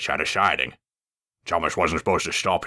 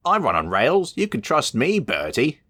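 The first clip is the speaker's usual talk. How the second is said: much higher, earnestly insisting; 10 semitones higher than usual. Acted. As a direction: protest that he can be trusted. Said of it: "he insisted".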